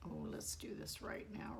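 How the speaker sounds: normal talking voice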